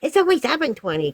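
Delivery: high-pitched protesting mimicry; imitated arguing customer. Customer tells pizza caller the earlier time, arguing. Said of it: "she kept arguing with me".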